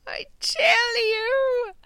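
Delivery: high, drawn-out playful yell; mock shout. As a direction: stretch it into a comic cry. Repeated playful declaration.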